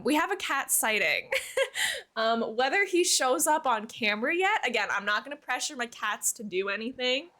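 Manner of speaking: ordinary delivery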